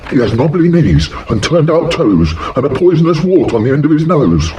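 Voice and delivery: high mouse voice. Acted. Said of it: "mouse voice, high".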